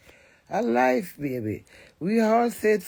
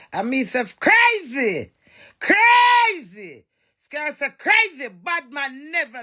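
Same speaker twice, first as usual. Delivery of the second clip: rising shout that peaks on the second crazy; 7 semitones higher, 4 dB louder, slower than usual. Real, not acted. Ranting to her followers, she shouts crazy again and again. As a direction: belt out crazy like a war cry.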